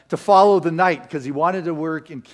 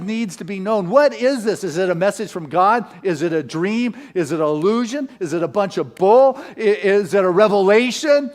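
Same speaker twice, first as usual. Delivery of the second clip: louder, higher, insistent preacher's delivery; impassioned preaching; 6 semitones higher, 7 dB louder than usual. Acted. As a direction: drive each question home to the congregation. Preaches at full force.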